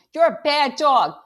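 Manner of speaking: put-on boy yelling at the dog. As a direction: shout it down at the dog. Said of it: "I'm yelling".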